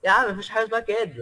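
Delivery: fast Egyptian-style burst; said in one breath. Shown as a demonstration. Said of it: "ثانية واحدة أخويا".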